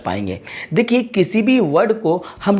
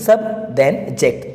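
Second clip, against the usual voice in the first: slow, with "सब" and "जेक्ट" set apart; syllable by syllable; 5 semitones lower, much slower than usual. Shown as a demonstration. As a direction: give "सब" and "जेक्ट" one separate beat each. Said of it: "तो हम इसको बोलेंगे". ("सब" और "जेक्ट" को धीरे, अलग-अलग बोलें)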